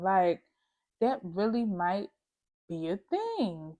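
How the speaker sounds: ordinary delivery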